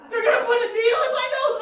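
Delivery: high, fast, loud mock bawl. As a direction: wail it like a hysterical kid, hammed up. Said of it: "Now he starts bawling".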